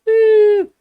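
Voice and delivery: high, loud whoop; high loud cheer; excited whoop. Acted. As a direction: let out a joyful woo. Cheer testing the mic.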